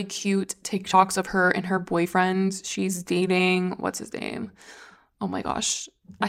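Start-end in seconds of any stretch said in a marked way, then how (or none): 3.7-5.9 trying to recall the name under her breath; breathy aside to herself; whispered aside. Real, not acted. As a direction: mutter it to herself as she searches her memory. Forgetting the boyfriend's name.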